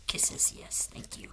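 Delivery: real whisper; hushed whisper close to the pup; whispering to the dog. Real, not acted. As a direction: murmur thanks for the kisses.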